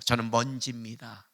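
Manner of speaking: hushed, lowly admission; humble confession. Retold or imitated. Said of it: "이렇게 고백했어요".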